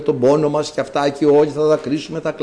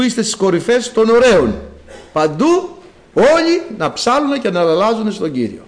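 raised, rhythmic call to praise; rally every listener to sing, pitched higher; preaching litany; 5 semitones higher than usual. Acted. Litany calling all to sing.

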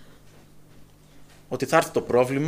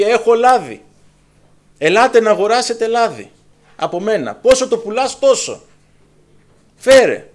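eager seller; 6 semitones higher, 8 dB louder than usual. Acted. Widow selling the multiplied oil.

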